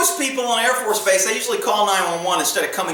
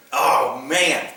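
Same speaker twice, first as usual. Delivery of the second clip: normal talking voice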